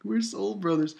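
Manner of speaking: a lightly voiced whiny friend; mock pleading character. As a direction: beg to go back. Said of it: "Ko's like".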